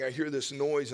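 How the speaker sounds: usual speaking voice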